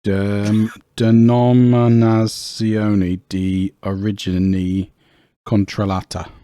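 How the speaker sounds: slow, lightly hammed-up Italian accent. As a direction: spell out the Italian label with mock flair. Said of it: "I'd love to hear your Italian accent".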